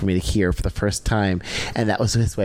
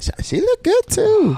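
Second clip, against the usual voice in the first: gushing; 4 dB louder than usual. Real, not acted. Gushing over actress.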